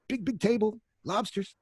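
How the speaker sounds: very high-pitched, put-on excitement; mock fussing. Waiter mimics the kitchen's fuss over lobsters, high and mocking. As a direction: send up the fuss in a high little voice.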